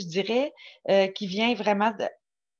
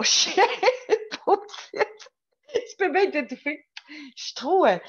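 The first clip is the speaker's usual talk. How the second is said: high, laughter-broken speech; laughing; 7 semitones higher, 3 dB louder, slower than usual. Real, not acted. Streamer tapping on the dog, laughing. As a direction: burst out laughing as you talk. Bursts out laughing mid-remark.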